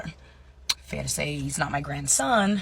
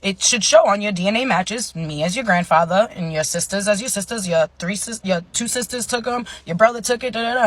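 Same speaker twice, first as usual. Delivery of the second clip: louder, higher chatty grandpa; grandpa imitation; 5 semitones higher, 6 dB louder than usual. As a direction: play an eager grandpa talking loudly. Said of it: "he's like".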